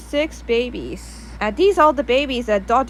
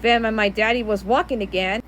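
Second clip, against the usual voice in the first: plain reading voice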